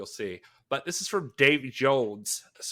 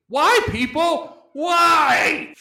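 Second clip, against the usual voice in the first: exasperated shout; 14 semitones higher, 8 dB louder, much slower than usual. Acted. Reading an exasperated review.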